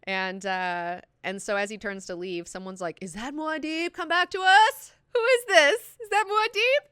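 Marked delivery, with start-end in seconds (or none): 3.0-6.9 high, loud mock-desperate cry; astonished onlooker. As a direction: call out in hammed-up awe and pleading. Voicing an astonished, pleading onlooker.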